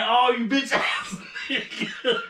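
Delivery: drawn-out, high, laughing groan of words; laughing mock exasperation. Storyteller re-enacting annoyance at his friend, laughing exasperation. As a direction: stretch the insult out, breaking into laughter.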